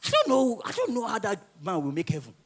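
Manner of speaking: ordinary talk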